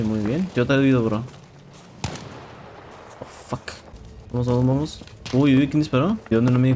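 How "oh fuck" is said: breathless whispered swear; whispered curse. Real, not acted. Surprised by sudden death in game.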